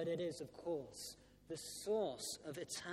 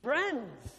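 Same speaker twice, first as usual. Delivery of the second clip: loud, high shout of Friends; shouted call; 5 semitones higher, 9 dB louder than usual. Retold or imitated. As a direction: call it out once more, insistent, to win the crowd. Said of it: "then he shouted again".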